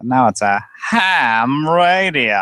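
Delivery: high, drawn-out silly punchline; goofy stretched-out wordplay; hammy pun delivery. Pretend.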